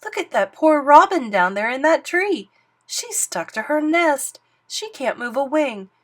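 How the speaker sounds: alarmed cry. Acted. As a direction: urge him to look. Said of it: "Bartholomew cried".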